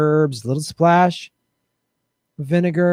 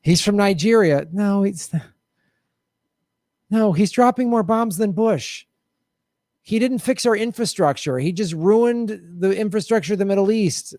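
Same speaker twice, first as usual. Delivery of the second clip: mocking Obama critics; 5 semitones higher than usual. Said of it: "It was".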